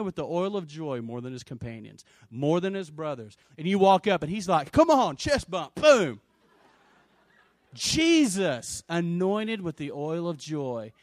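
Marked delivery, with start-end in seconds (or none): 4.7-6.2 high, pumped-up buddy call; playful, punchy exuberance; boisterous greeting. Acted. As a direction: hype up a chest bump. Imagined Jesus greeting you boisterously.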